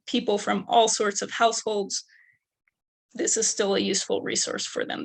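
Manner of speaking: ordinary talk, nothing marked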